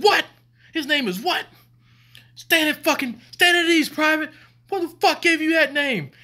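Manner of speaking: high, loud retold drill-sergeant outrage; incredulous shout, playing the sergeant; barking sergeant. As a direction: bark at a recruit in mock fury. Sergeant barking at a new private.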